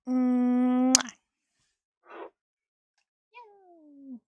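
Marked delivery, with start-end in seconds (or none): 0.1-1.1 loud smacking air kiss. Acted. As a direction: blow a big loud kiss. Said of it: "Here's a kiss for you".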